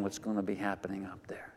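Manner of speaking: hushed, quick whisper of wonder; awed whisper. Acted. Preacher whispering to congregation about heaven's wonder. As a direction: breathe it like a secret of heaven.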